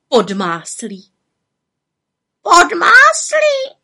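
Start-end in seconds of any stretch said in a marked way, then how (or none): 2.4-3.8 very high, loud, drawn-out squawk; raven croak. A narrator's reading. Raven echoing the botched rain, incredulous croak. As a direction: croak it back in disbelief. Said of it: "krákal havran".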